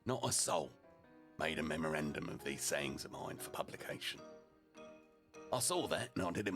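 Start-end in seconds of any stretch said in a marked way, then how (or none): none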